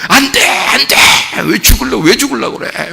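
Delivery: high, forceful outburst of objection; acted alarmed protest. Peter objecting to Jesus announcing his death, acted alarmed protest. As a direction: cry out as if stopping someone from going to die.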